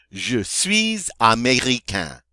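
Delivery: slow "Je suis américain." with z link; French pronunciation. Shown as a demonstration. Teacher models the example sentence, slowly in French. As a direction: draw out "américain" to a nasal ending. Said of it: "this would translate as".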